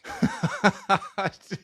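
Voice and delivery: high-pitched burst of laughter; real laugh at a wild story; amused laugh. Real, not acted. Reacts to the [name] story.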